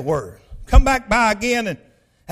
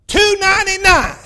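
high, loud exclamation; triumphant announcement; 10 semitones higher, 7 dB louder than usual. Retold or imitated. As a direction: belt out the bargain price in triumph. Proudly announcing bargain price to family.

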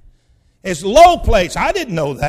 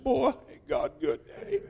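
chin quivering; 2 semitones higher, 9 dB quieter than usual. Retold or imitated. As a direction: imitate a child softly holding back tears. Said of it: "got this chin just quivering".

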